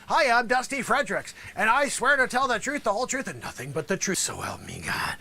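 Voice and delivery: lawyer coaching the witness; fade at the end. Shown as a demonstration. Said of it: "come down to a whisper".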